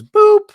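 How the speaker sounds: very high, loud little boop; mock boop. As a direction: pop out a squeaky, sudden boop. Imitating Katie's interjected noise.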